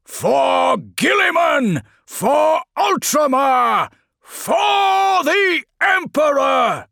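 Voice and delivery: high, slow, drawn-out battle shout; Felix's war cry. A narrator's reading. Felix at the chapel doors rallying his marines, shouting. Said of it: "He raised his sword and shouted".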